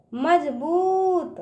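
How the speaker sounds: slow मजबूत, बू held long; drawn-out long ū. Shown as a demonstration. Teacher showing children, मजबूत with drawn-out ū. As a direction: stretch the ū in मजबूत. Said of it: "इस तरह से".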